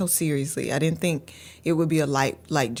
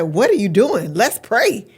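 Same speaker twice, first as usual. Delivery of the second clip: put-on higher, louder scolding from a young girl; chastising little daughter; 4 semitones higher, 7 dB louder than usual. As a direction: imitate a child scolding her mother. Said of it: "she chastised me immediately".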